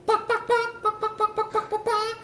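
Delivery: high mock chicken clucks; chicken clucking. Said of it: "It sounds like you're going".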